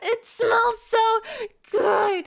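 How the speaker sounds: ecstatic shriek. Acted. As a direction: scream with delight at the smell. Shrieks about the scent.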